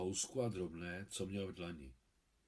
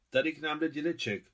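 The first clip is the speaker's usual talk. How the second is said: higher, raised-voice announcement; said aloud; 5 semitones higher, 4 dB louder than usual. A narrator's reading. Father announces Adam's arrival to those inside, aloud. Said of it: "řekl nahlas Miťkův otec".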